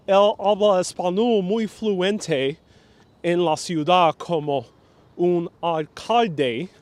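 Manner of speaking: very strong American accent in Spanish; slow, loud Spanish with no effort at native pronunciation, all American accent; Mike Bloomberg. Shown as a demonstration. Demonstrating the former mayor's Spanish, slow thick American accent. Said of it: "So the way he speaks Spanish".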